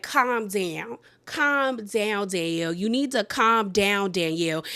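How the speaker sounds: condescending calm down. Retold or imitated. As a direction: talk her down coolly. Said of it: "Jennifer is like".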